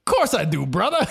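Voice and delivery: high, loud hearty cover-up; Stone Man bluffing. Retold or imitated. Stone Man covering his mistake, high and hearty. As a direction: brush it off with big cheer.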